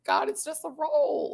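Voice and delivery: airy, lightly mocking shrug; breathy mock dismissal. Retelling her reaction to viewers, breathy and high. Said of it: "I'm like".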